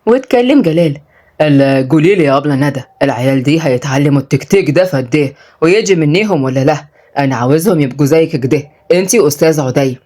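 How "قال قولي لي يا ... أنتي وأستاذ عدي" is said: low, rustic male voice; village father. Acted. Father asks teachers about his children's progress, low. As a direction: drop low as the head of household.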